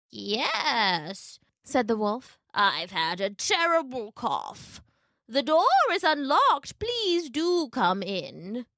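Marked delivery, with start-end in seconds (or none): 0.1-1.4 drawn-out, swooping disguised voice; wolf as grandmother. A narrator's reading. Wolf pretending to be grandmother, drawn-out fake voice. 2.5-8.6 slow, swooping fake granny; wolf as grandmother. A narrator's reading. Wolf luring Little Red inside, slow fake grandmother voice.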